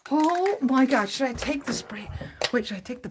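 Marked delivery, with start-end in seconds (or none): 0.1-1.1 loud, a little slow; amazed. Real, not acted. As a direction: still stunned. Second exclamation, less drawn.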